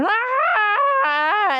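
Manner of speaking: high drawn-out scream; mock scream going down. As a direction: scream down the steep slide. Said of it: "you go down and you're".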